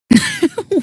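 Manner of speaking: high, mocking burst of laughter; villain laugh. Villain mocking the heroes, high mocking laugh. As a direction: cackle down at them.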